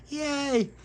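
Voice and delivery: very high tiny yay; baby's cheer. A narrator's reading. Baby Lucia cheering, very high. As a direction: squeak like a toddler.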